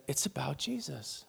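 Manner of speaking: soft, hushed realization; said to herself. Retold or imitated. Woman to herself after hearing the gospel, hushed realization. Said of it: "just to herself".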